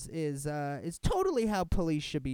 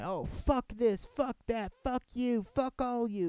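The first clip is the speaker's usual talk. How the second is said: higher, slowed mock tirade; mock cussing cop; 9 semitones higher, 3 dB louder, slower than usual. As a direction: lightly ham up a swearing cop. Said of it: "throwing around cuss words".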